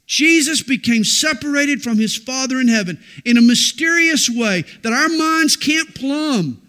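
preacher declares the mystery of the cross at full force; fervent preaching. Acted. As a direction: drive the mystery home from the pulpit.

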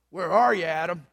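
gruff, loud demand; gruff reading. Shown as a demonstration. Pastor reading God's call to Adam, gruff. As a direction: read it gruffly, hard and loud. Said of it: "If the aspiring pastor read it gruffly".

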